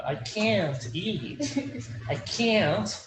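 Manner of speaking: very slow, every word spelled out for the class; slow drill. Shown as a demonstration. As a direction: stretch it out so they can copy. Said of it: "student repeats after teacher".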